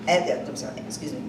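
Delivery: ordinary talk, nothing marked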